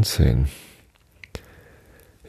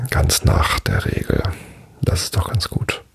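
whispering. Real, not acted. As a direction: whisper it half to yourself. Whispered aside in outro.

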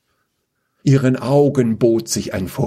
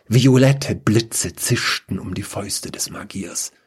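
ordinary delivery

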